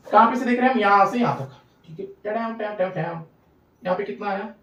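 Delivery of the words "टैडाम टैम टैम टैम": low, soft "tam-tam" sound effect; little imitated steps, hushed and low; soft counting-off noise. Shown as a demonstration. Teacher tracing the path for students, soft imitated step noises.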